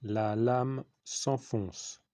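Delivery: very slow, drawn-out words with a long break; slow dictation. Real, not acted. Teacher repeating a phrase for writing, very slow dictation. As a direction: stretch each word for pupils writing it down.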